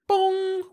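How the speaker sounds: loud, high ringing imitated gong; machine bong. A narrator's reading. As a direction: sound a high alarm chime with the voice. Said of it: "went the Monitex".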